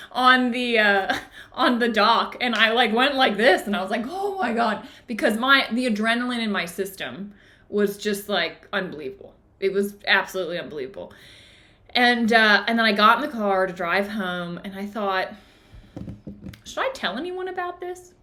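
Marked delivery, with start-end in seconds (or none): 4.1-4.9 higher-pitched re-enacted shudder of shock; shaken relief. Re-enacts shaking off the adrenaline. 16.7-18.1 high, softly posed question to herself; wondering thought. Retold or imitated. Retells her private hesitant thought.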